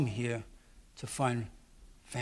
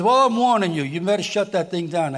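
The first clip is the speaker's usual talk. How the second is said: stern warning; 3 semitones higher, 9 dB louder than usual. Retold or imitated. Retold threat from city manager.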